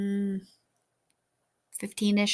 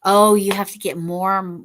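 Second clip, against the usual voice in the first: ordinary talk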